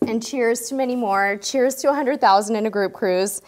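ordinary delivery

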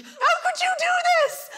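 high, slow mock-outraged mum; exasperated mum; angry mum. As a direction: play the furious mother. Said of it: "She's like".

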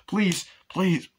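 high, urgent plea; acted frantic appeal; mock desperate begging. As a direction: imitate a man begging an officer. David begs a police officer.

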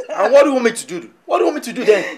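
loud exasperated demand, fast at first; cornered man shouting back; heated shout. Acted. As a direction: throw the question back at her in anger.

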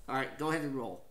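normal talking voice

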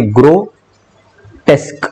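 ग्रोटेस्क broken slowly into "gro... tesk"; slow pronunciation demo. Shown as a demonstration. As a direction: pause between the two syllables of ग्रोटेस्क. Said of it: "pronunciation कैसी होती है".

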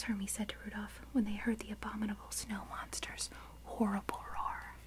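plain reading voice

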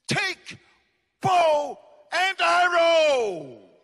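commanding shout. Acted. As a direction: bark the order like a prophet to a king. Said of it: "He said to him".

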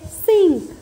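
सिंक said loud with a clear ee vowel; ee sound. Shown as a demonstration. Teacher showing the ee sound in सिंक, loud and clear. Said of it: "इसमें ई sound है".

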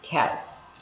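"Cats" ending in a long voiceless hiss; crisp T, then sustained S in "Cats"; sounded final S. Shown as a demonstration. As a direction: stretch out the S of "Cats". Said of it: "âm S thì chúng ta phải bắt buộc".

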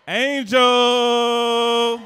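loud stretched name call; host announcement. Acted. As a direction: call her out like a ring announcer. Announcing last contestant.

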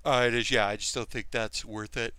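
talking through a yawn; sleepy, yawning words. Real, not acted. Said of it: "apologize for the yawns".

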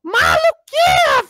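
high, loud, slow squeal of delight; going crazy. Shown as a demonstration. Grandma showing the kids how crazy motorbikes make her, high slow squeal. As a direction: show how wild grandma gets. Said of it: "A vovó fica assim, ó".

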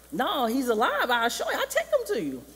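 high, laughing eagerness; Rafiki. Retold or imitated. Rafiki promises Simba his father, high and eager. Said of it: "we see Rafiki again".

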